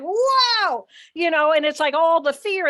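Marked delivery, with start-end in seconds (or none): 0.0-0.9 high, loud burst of fright; startled wow. Retold or imitated. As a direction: blurt it out at the snake, high and loud.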